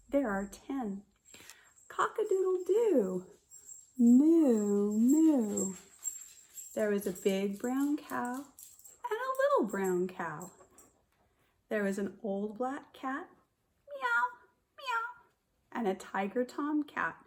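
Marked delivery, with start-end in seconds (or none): 1.9-3.3 high crowing rooster call. Acted. Teacher crowing like a rooster for the children. 4.0-5.7 loud mooing cow. Acted. Teacher mooing like a cow for the class. 13.9-15.7 high mewing cat; cat meow. Acted. Teacher meowing like a cat for children.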